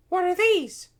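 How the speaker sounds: very high, loud little-princess question; puzzled princess. A narrator's reading. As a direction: ask in a high, curious princess voice. Princess asks about coins.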